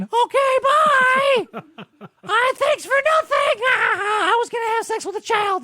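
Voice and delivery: very high, loud squeaky goodbye; high mock toddler voice; squeaky childish voice. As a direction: chirp it like a cartoon kid. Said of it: "via the voice of a three-year-old".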